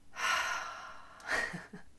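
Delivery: long breathy exhale; long sigh. Real, not acted. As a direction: let out a long, unvoiced sigh. Long exhale while centering before the talk.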